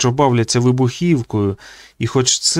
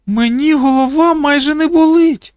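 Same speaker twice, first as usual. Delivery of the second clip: put-on very high, louder girlish voice; revived girl; 5 dB louder than usual. A narrator's reading. Girl whose headache has nearly gone, high-voiced relief. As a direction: announce it in a raised, childlike pitch.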